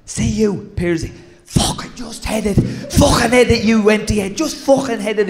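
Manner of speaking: hammed-up dressing-room rant; mimicking Cookie's rant. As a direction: bark the blame like an angry old teammate. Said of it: "and then he'd go".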